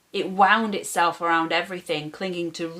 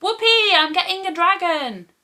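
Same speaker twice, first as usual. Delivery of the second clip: much higher, louder whoop of glee; mock whoop; 10 semitones higher, 5 dB louder than usual. Boy imagining cheering about a dragon, hammed-up squeal. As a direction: pretend to squeal with childish joy. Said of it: "jump up and down screaming".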